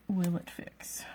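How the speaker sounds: breathy whisper to herself. Real, not acted. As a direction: whisper it, worried it won't work. Anxious fixing of moved piece.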